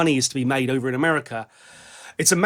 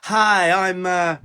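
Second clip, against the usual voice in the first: mock transatlantic drawl, higher than usual; higher-pitched send-up of a transatlantic drawl; 4 semitones higher, 4 dB louder, slower than usual. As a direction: put on a stupid transatlantic drawl. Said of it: "drawl that he does".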